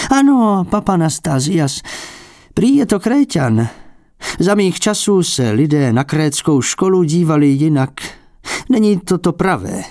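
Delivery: put-on a prickly old painter feigning modesty; fussy old abbot. A narrator's reading.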